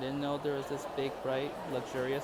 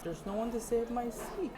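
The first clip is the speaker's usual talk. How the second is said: mock whine; 10 semitones higher than usual. Self-pity about dining alone.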